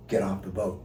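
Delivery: a quiet, awed answer from above; hushed voice of the Lord. Retold or imitated. Recalling the Lord telling him softly to leave.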